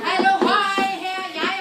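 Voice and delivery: high, loud hail; loud high call from behind; shouted hallo. Acted. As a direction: yell it out to the brothers. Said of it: "råbte Klods Hans".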